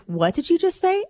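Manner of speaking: dad catching his daughter's slip; mock shocked dad. Mom voicing the husband's startled "What did you just say?".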